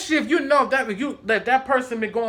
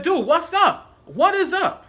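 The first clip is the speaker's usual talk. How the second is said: raised-pitch challenge; confrontational; 6 semitones higher than usual. Retold or imitated. Walking up on coworker to challenge him, confrontational. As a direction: demand an answer, voice up.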